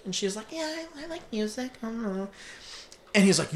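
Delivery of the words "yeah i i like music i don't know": put-on girl. Girl voiced in retold scene.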